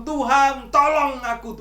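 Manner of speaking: loud, high cry for help. Retold or imitated. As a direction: shout the plea up to God. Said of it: "kita teriak". (berteriak keras minta tolong)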